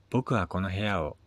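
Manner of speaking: ordinary delivery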